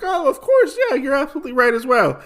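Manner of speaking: high-pitched, hurried, agreeable husband; eager agreement. Retold or imitated. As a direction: agree readily, pitched well up. Husband agreeing in retold talk.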